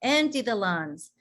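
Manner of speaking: slow counted breathing cues; guided breathing. Shown as a demonstration. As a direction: pace their breaths, calm and slow. Leads a breathing exercise.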